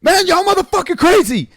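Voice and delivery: hammed-up terrified guy; high mock voice. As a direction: shriek it like a panicked stranger. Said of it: "and he goes".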